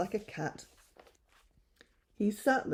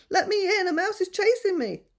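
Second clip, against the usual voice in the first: panicked plea; 7 dB louder than usual. Acted. Lion begging in panic.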